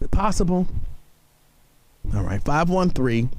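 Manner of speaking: ordinary talk, nothing marked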